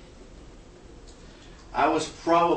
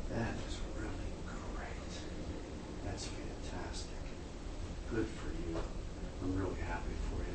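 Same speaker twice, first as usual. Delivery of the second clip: quiet; 5 semitones lower, 12 dB quieter than usual. Retold or imitated. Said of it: "The doctor turned to him and said".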